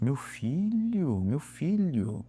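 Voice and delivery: soft, slow, head-shaking "meu filho"; exasperated. Real, not acted. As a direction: sigh it out in disbelief. Exasperated at the gym-obsessed man. (desabafo lento e baixinho de quem desiste)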